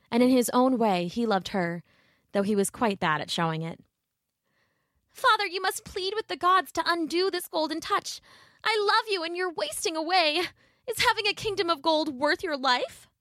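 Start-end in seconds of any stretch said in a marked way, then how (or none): 5.1-13.1 high, pleading daughter; Marigold. Acted. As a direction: implore a wasting father.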